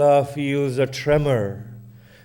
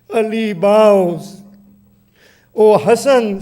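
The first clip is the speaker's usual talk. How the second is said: loud, higher wailing appeals; anguished cries; 5 semitones higher, 7 dB louder than usual. Acted. Fatima calling Muhammad and Ali to save her child, wailing loud. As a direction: cry out in grief to the family.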